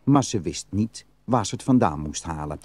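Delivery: usual speaking voice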